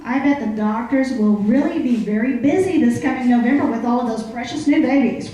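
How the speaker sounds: usual speaking voice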